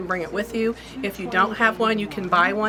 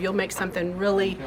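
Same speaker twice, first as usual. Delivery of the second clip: normal talking voice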